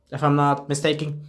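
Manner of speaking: normal talking voice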